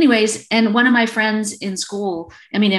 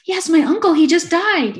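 high, louder mock-amazed outburst; eager psychic's client; 11 semitones higher, 6 dB louder than usual. Client falling for a psychic's guess, mock eagerness. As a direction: imitate a gullible client jumping at a vague guess.

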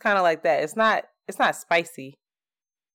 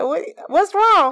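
high surprised; 9 semitones higher, 4 dB louder, slower than usual. Retold or imitated. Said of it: "I said".

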